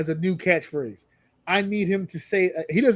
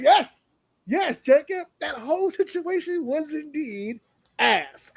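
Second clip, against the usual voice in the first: mock gleeful agreement; 8 semitones higher, slower than usual. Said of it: "I was like".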